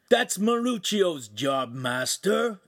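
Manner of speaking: high, slow, put-upon complaint; complaining. A narrator's reading. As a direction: drag it out like a lazy apprentice. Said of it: "Ugolino complained".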